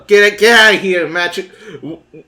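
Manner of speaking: loud shoo-away shout. Acted. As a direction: chase him off loudly. Shooing the wizard.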